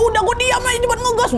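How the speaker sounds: very high, urgent command; frantic retold order. Friend telling the rider to speed away, frantic. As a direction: reenact a panicked order at high pitch.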